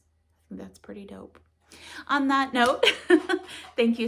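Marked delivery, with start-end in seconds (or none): 2.1-2.8 laughing. Real, not acted. Laughing sign-off. 2.9-3.7 short high laugh burst; bright laugh. Real, not acted. Laughs after on that note.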